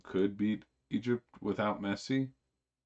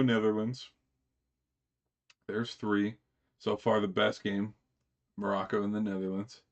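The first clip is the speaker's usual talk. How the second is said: usual speaking voice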